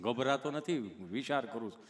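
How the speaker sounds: low, halting stammerer; tongue-tied Gabho. Retold or imitated. Gabho telling the nurse he's thinking, low stammer. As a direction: stick before each phrase, pitched low. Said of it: "ચોંટે અટકે".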